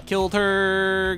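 ordinary talk

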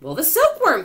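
high, loud exclamation; elated high shout; excited cry. A narrator's reading. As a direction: cry out the obvious answer. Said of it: "cried the old green grasshopper".

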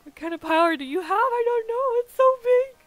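high, squeaky cartoon voice, slowed; squeaky put-on voice. Gamer to stream chat, in a high squeaky put-on voice. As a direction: squeak out the words like a tiny cartoon creature.

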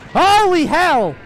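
high, loud drawn-out yell; startled shout. Real, not acted. Player startled by an attack, shocked yell.

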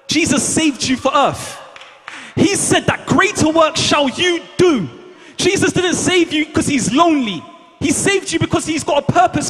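fiery preaching. Real, not acted. Preaching at full force.

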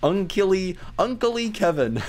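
mock childish Unkily; misspelling read out. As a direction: pronounce Unkily like the typo. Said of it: "he spelled uncle wrong".

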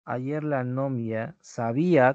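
slow, spelled-out reading; slow dictation. Shown as a demonstration. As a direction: dictate it slowly to the class. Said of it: "con tilde en la I".

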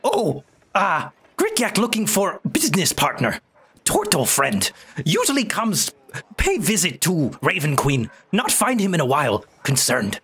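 high broken-English goblin; squeaky little goblin merchant. Acted. Goblin voice continues.

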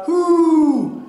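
high, drawn-out imitated hoot; loud seal call. A narrator's reading. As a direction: call "hoo" like a mother seal. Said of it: "they heard a loud".

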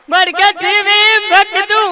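high, loud, slow wailing widow; mock wailing lament. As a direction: wail it out like a hammed-up mourner. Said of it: "રડતા રડતા કહેતા હતા".

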